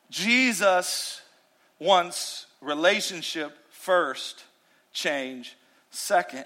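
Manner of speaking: very slow, soft, deliberate statement; preacher's slow key line; slow emphatic point. Real, not acted. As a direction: land the main point word by word. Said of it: "here's my main point".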